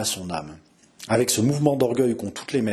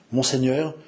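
ordinary delivery, nothing marked

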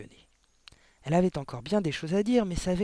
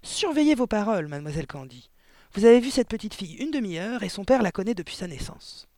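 loud, higher, scolding; indignant headmistress warning; stern rebuke; 6 semitones higher, 6 dB louder than usual. A narrator's reading. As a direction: put a subordinate in her place.

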